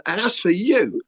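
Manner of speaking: high, pointed turn on someone; imitated accusation. Manager turns on injured player, imitated high-pitched accusation. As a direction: round on the next victim, mimicking.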